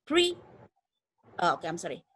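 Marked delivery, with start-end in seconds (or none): 0.0-0.4 Pre said high and punched; stressed syllable alone. Shown as a demonstration. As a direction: pitch Pre up and hit it. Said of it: "stressnya di pre".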